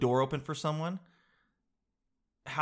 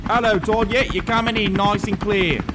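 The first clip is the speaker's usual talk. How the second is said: British reporter voice; 6 semitones higher, 7 dB louder than usual. Acted. Skit correspondent Nigel voice.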